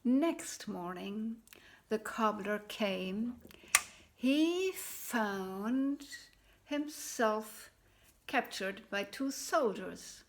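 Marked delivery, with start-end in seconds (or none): none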